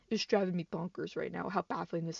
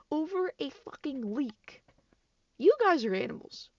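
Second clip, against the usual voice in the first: exasperated outburst; 7 semitones higher, 4 dB louder than usual. Real, not acted. YouTuber scolding the fans who harassed a leaker, exasperated and loud. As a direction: let the disbelief boil over into an insult. Ranting at the toxic community.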